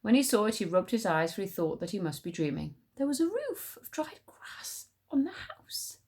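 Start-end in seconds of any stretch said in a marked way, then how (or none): 3.0-6.0 high slow whisper; whispered surprise at the roof. A narrator's reading. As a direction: hush it in wonder. Hushed discovery.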